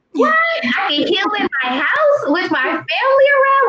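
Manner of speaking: high, shouted cry of disbelief; amazed disbelief. Retold or imitated. Patient reliving hearing she can heal at home, amazed shout. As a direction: yelp it out like the news is too good to be true. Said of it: "I was amazed by all of this".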